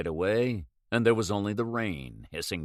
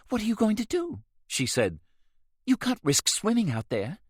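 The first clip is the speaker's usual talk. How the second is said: higher, worried pleading; worried Rose; 12 semitones higher than usual. A narrator's reading. Rose objecting to the swim, worried. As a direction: beg him not to go.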